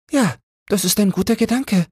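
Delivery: very high, prim bird voice; a comfortable little bird who won't leave; fussy sparrow wife. A narrator's reading. A nesting sparrow answering the flock, very high and prim. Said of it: "sagte einer von denen".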